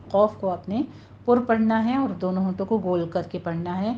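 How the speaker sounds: normal talking voice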